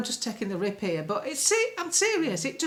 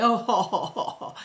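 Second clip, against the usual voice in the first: hammed-up posh guffawing, mocking MPs; a fake hearty ho-ho laugh. Mocking MPs laughing in the Commons, hammed-up guffaw. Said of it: "they're all laughing and guffawing".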